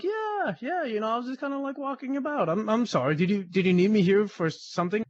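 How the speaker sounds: high, fast sheepish backpedal; sheepish innocence. Retold or imitated. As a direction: play dumb, retelling it to the captain. Retold sheepish reply to captain.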